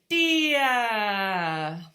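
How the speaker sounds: put-on loud, high, drawn-out toddler word; imitating toddler. Mum imitating her toddler's first words, loud drawn-out toddler voice. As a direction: stretch it out loudly like a toddler. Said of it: "he started saying".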